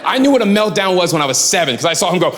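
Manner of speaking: normal talking voice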